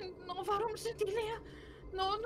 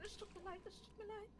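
much softer, higher anxious apology; scared apology; 5 semitones higher, 11 dB quieter than usual. Real, not acted. Player apologising to the creature, soft and scared. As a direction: plead sorry under your breath.

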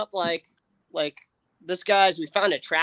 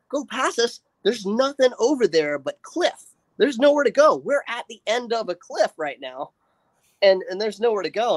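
higher-pitched, incredulous panic; retold freak-out; 5 semitones higher than usual. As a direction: argue back in a rising panic, retelling it. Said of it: "freaking out at this point".

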